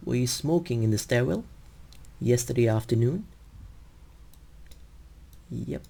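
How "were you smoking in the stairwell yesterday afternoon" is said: tune rising at the end on "yesterday afternoon"; rising yes-no tune. Shown as a demonstration. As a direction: let the pitch climb on "afternoon". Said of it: "ends with a rising tone".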